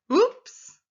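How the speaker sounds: high, loud rising exclamation; surprised and excited. Shown as a demonstration. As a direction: let it shoot up in surprise. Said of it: "I'm sounding surprised and excited".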